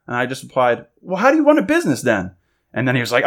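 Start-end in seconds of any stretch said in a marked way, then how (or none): 1.0-2.3 retold testy comeback, pitched high; imitated challenging retort; not polite. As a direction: fire back at a rude customer, mildly mocking. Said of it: "I guess not polite like that".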